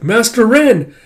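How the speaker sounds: high, loud character cry; acted excited shout; calling out.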